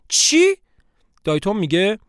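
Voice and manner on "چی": high, loud shocked cry; shocked what. Acted. Three siblings gasp at the pregnancy test, stunned. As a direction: blurt it out in total shock.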